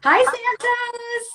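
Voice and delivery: high, loud, drawn-out hello; big high-pitched hello to an arriving guest; excited greeting. Real, not acted. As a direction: sing out a delighted welcome, stretching it. Greeting guest joining the live show.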